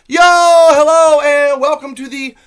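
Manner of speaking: loud, high, stretched-out hello; announcer-style show opener; hyped host greeting. Acted. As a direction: welcome the listeners like a game show host. Over-the-top show greeting.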